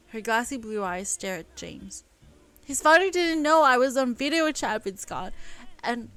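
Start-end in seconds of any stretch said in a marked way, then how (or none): 2.6-6.1 higher, louder wounded account; indignant complaint. Acted. Elle telling James about the insult, indignant. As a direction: let the hurt show.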